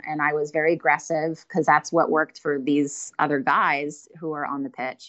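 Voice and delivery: usual speaking voice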